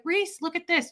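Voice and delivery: high, sugary imitation of mom; lightly voiced doting mother; sweet mom voice. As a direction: mom coaxing her cranky daughter.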